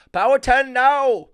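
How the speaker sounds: put-on slow, very high and loud German-accented shout; German accent yell. German teammate yelling the stroke call, German accent. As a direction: belt the call across the boat in German accent. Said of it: "in his German accent".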